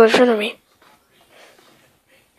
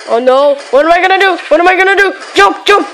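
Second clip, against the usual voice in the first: panicked; 4 semitones higher, much faster than usual. Real, not acted. Panic under attack.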